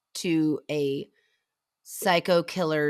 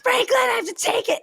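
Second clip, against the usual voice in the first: screaming. Retold or imitated. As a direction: shriek it like a horror heroine. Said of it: "she's in perma scream mode already".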